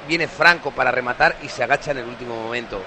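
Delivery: ordinary talk, nothing marked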